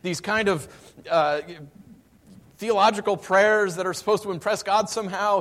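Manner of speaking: fed up, pour it out; preacher voicing a worn-out believer; exasperated prayer. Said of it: "you're finally like".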